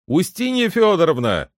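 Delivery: loud call. A narrator's reading. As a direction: call out to someone in another room. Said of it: "крикнул он громким голосом своей жене". (громкий окрик жене)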